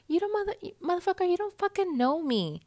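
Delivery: high-pitched indignant outburst; pissed-off, high-pitched protest; heated. Real, not acted. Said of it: "I'm pretty heated here".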